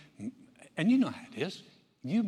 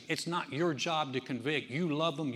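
usual speaking voice

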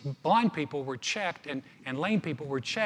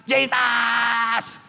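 loud, drawn-out high shout; acted yell; 6 semitones higher, 5 dB louder than usual. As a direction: bellow the name over a crowd. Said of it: "he yelled all the more, got louder".

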